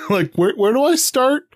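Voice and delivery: high mock-lost plea; lost question. Stuck on where to start, high puzzled plea. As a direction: ask it like you're hopelessly lost, pitched high. Retelling own confused search.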